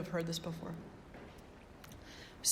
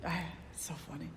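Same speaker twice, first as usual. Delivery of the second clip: amused whisper, breathy and quick; hushed amused aside; 3 semitones lower, 7 dB quieter, much faster than usual. Real, not acted. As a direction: let the laugh leak out in a whisper.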